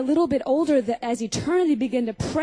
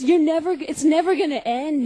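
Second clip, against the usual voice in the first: ordinary delivery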